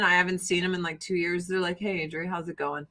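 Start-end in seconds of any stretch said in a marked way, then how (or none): 1.8-2.9 monotone greeting. Retold or imitated. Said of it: "my family is monotone".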